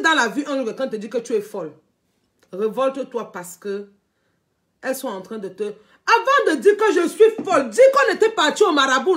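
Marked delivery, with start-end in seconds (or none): 5.6-9.2 laughing challenge. Real, not acted. Laughing, mocking challenge to rival.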